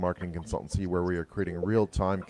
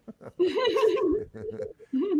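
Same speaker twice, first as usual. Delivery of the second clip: high laugh; 3 dB louder than usual. Real, not acted. Laughing at own accent joke.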